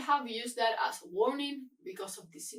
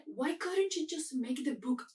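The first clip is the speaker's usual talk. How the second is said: normal talking voice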